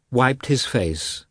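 usual speaking voice

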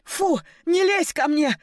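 high-pitched, revolted whine; moaning with revulsion; disgusted moan. A narrator's reading. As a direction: recoil in disgust, voice jumping up. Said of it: "простонала, скривившись от отвращения".

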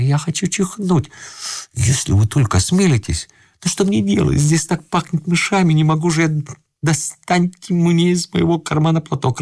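a desperate man begging under the bed; pleading old man. A narrator's reading. Husband-intruder pleading voice.

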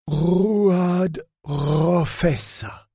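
Rouad Rofessa declaimed slowly, high, rolling every r; rolled r's. A narrator's reading. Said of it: "déclama ... en roulant les airs".

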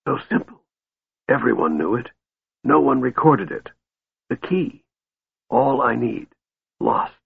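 weak, soft broken phrases; gasped. A narrator's reading. Dying mage lamenting the lost key, gasping. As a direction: gasp out each phrase softly, pausing for breath. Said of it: "Raistlin gasped".

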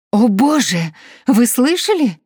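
high, loud panicked cry; hysterical scream. Acted. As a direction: shriek it in panic. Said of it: "Истерический вопль".

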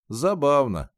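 растянуть слово, повыше и громче; произнести нараспев, с высоким подъёмом; протяжно. A narrator's reading. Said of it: "протянул Ренни". (задумчиво протянуть)

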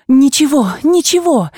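quick, rising cry of ничего; snatch up the reply eagerly. A narrator's reading. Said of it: "с живостью подхватила она". (с живостью, высоко: «ничего, ничего!»)

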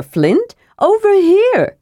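high-pitched kender call; Tass calling out. A narrator's reading. Kender beckons dwarf over to him, calling out high. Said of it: "Tass called".

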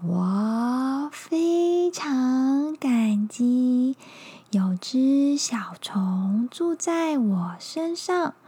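very slow drowsy sloth voice; super slow sleepy sloth. Acted. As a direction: drag every word like a sloth. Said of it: "树懒笑着说".

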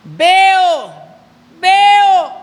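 loud, high, drawn-out cry; retold shouting. Man who could not see begins to shout, loud imitated cry. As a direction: shout it out at full volume, imitating him. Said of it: "y empieza a gritar".

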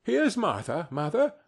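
put-on lightly lifted, girlish greeting; girl announcing herself. A narrator's reading. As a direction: announce your arrival as a young girl. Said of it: "said a girl, appearing as she spoke".

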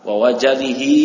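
Wa wajalihi with two clear wa sounds, falling at the end; teacher models the right reading, firm; corrected pronunciation. Shown as a demonstration. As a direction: spell out both wa in Wa wajalihi. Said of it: "Itu wawunya kan dua".

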